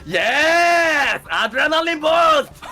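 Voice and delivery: triumphant shout. Pretend. Player triumphantly activates a boost.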